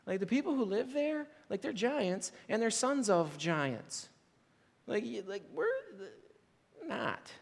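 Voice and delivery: quiet, hushed fearful report; fearful spies. Retold or imitated. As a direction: sound scared of the giants. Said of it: "they said".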